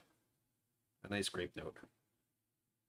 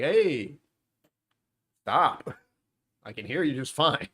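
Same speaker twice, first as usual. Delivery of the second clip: loud, raised-pitch mock protest; sarcastic comeback; 5 semitones higher, 6 dB louder, slower than usual. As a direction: talk back sarcastically to someone yelling at you. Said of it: "I'm too sarcastic for my own good".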